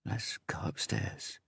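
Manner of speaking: slow breathy whisper. A narrator's reading. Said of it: "he whispered".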